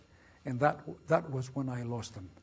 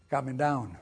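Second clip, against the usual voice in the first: usual speaking voice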